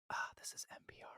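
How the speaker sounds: hushed, whispery parody of a radio host; mock NPR voice. Podcaster spoofing NPR as a joke, in a hushed voice. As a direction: put on a very soft public-radio murmur. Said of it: "No, I'm just kidding".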